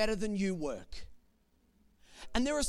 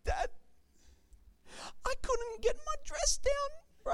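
put-on high, tearful little-girl voice; imitating four-year-old daughter; 3 dB quieter than usual. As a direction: play the sobbing four-year-old, pitched much higher. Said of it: "and she says".

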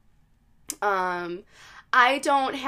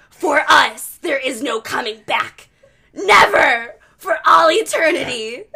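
very loud, raised demon proclamation; possessed woman imitation; 5 semitones higher, 12 dB louder than usual. Podcaster imitating demon answering priest, loud possessed voice. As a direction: declaim it like a hammed-up demon. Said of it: "I'm gonna try to imitate it".

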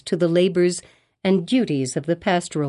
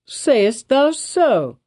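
slow surprised cry, higher; drawn-out startled question; higher, slow mock astonishment; cried out; 6 semitones higher, much slower than usual. A narrator's reading. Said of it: "cried the governor".

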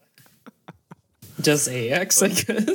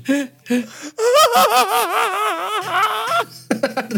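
high shrieking cartoon cackle; loud squealing cartoon laugh; 4 dB louder than usual. Real, not acted. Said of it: "That was a cartoon laugh".

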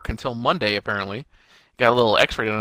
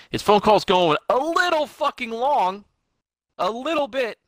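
high, drawn-out complaint; pointed impatience; 10 semitones higher, slower than usual. Real, not acted. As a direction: stretch it out high in mock-patient annoyance. Co-host impatient at long phone call.

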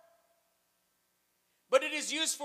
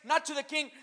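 ordinary delivery

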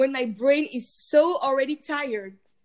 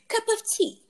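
mock posh English; 10 semitones higher than usual. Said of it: "English accent makes, ... you will say".

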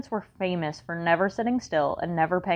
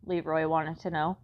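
plain reading voice

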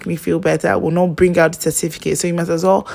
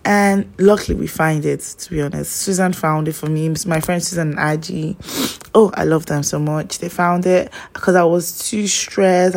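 normal talking voice

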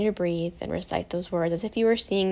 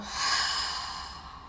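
long, audible breath out; deep releasing sigh; letting-go exhale; 5 dB quieter than usual. Shown as a demonstration. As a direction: sigh it all out slowly. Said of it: "let go of anything that's not serving you".

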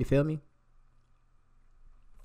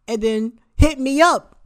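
higher, louder and slow in disbelief; indignant; 11 semitones higher, 8 dB louder, slower than usual. Real, not acted. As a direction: stretch it out, outraged. Indignant at her coming back.

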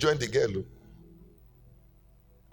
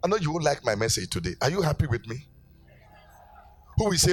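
preaching at full force; 3 semitones higher than usual. Acted. Pastor urging the church to serve, preaching hard. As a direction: drive the message home.

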